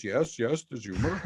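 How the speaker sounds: lightly voiced boss agreeing; Lloyd imitation. Lloyd agreeing there's humor, lightly imitated. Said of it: "that's my Lloyd imitation".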